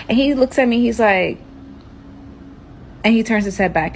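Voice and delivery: usual speaking voice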